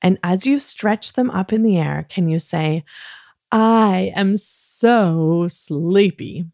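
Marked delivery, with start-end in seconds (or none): 3.5-6.4 very slow, drawn-out mock sleepiness; dramatically sleepy. Shown as a demonstration. Host shows children the bedtime line, dramatic sleepy delivery. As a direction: stretch every word like a sleepy actor. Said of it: "be very dramatic about this".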